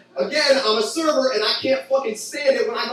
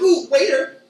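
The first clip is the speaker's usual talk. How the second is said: higher, louder needy customer; mock customer; 6 semitones higher, 4 dB louder than usual. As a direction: call the waiter eagerly. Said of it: "they're like".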